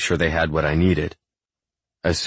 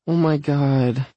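high, drawn-out whine; whining; 10 semitones higher, slower than usual. A narrator's reading. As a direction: whine it in a high female-character voice. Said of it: "she whines".

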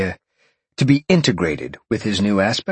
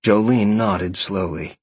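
usual speaking voice

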